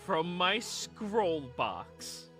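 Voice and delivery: dramatic reveal. Acted. Shopkeeper offering a customer the scroll box, dramatic reveal. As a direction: announce it grandly, stretching the words out.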